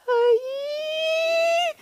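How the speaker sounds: high squealing cry of fright; imitating a scared lady on a coaster; mock scared cry. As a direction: squeal like a terrified rider. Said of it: "She's scared".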